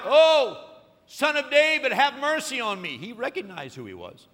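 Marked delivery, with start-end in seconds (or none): 0.0-3.0 high, loud pleading cry; crying out for mercy. Retold or imitated. Blind beggar cries out to passing Jesus, loud pleading. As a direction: call out to be heard over the crowd. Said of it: "he begins to cry out".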